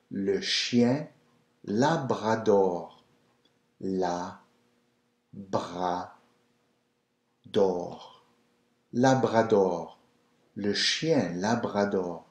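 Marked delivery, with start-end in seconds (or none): 1.7-12.2 slow Labrador with rolled French R. Shown as a demonstration. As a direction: roll the R's in Labrador slowly. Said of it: "So you're rolling the R".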